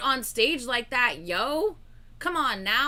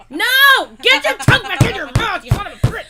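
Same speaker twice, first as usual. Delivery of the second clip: loud, higher scolding yell; mock outraged yell; yelling at screen; 5 semitones higher, 11 dB louder than usual. Real, not acted. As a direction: shout at the dancer.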